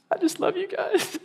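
mock a starstruck fan swooning; gushing fan.

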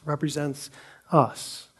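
ordinary talk, nothing marked

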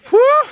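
sharp high winded exhale; breathless whew. Real, not acted. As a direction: blow out hard after rushing. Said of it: "Going too fast for myself".

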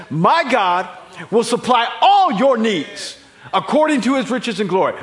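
loud, high pulpit proclamation with "all" hit hard; emphatic proclamation. Retold or imitated. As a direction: thunder the promise to the congregation. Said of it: "An emphatic, didactic statement".

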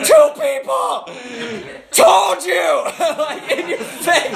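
very high, loud taunting shout; immature, bold gloat. Retold or imitated. Preacher gloating to doubters after two healings, immature shout. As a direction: crow it slowly in childish triumph. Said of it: "totally immature, bold again".

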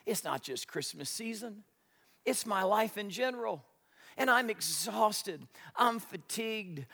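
soft, worn-out complaint; drained, barely lifting the voice; exhausted. Retold or imitated. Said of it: "You say".

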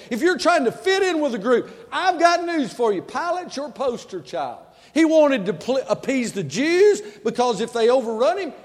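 high, loud, driving sermon delivery; full-force preaching. Acted. Preacher confronting people trying to fit in, full-force preaching.

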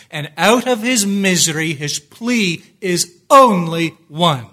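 loud, emphatic sermon delivery; full-voiced pulpit proclamation; impassioned preaching. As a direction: drive each phrase home forcefully.

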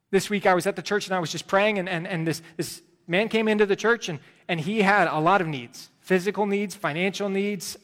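preached testimony; preaching testimony. Acted. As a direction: tell it with fervour. Preaching at full force.

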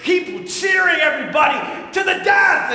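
high, loud jeering yell; mocking crowd shout. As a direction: jeer and whip up the crowd. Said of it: "They're like".

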